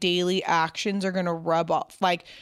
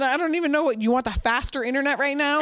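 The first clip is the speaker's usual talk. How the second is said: higher-pitched mock complaint; joking exasperation; 6 semitones higher than usual. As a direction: grumble for laughs, pitch raised. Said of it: "a funny way".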